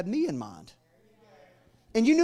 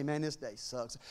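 mock grumble; 7 semitones lower, 9 dB quieter than usual. Repeats God's mock grumble.

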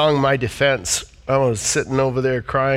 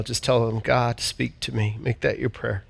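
pastoral prayer; 4 semitones lower than usual. Acted. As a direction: pray over the people gently. Leads congregation in prayer.

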